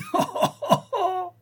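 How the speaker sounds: high, mad burst of laughter; convulsive laugh. Acted. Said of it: "rire d'un rire convulsif".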